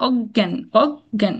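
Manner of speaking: অজ্ঞান with a held double g, "og-gyan"; doubled g. Shown as a demonstration. Teacher showing mid-word rule, double g in অজ্ঞান. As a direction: hold the g in অজ্ঞান. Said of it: "double উচ্চারণ আর কি".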